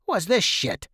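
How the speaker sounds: sudden disgusted outburst; disgusted father. A narrator's reading. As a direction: demand it like an outraged dad.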